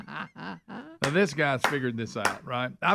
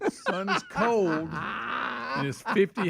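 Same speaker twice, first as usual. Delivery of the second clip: high, slow mocking imitation; 8 semitones higher, much slower than usual. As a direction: drag it out mockingly, laughing. Mocking the flat earther.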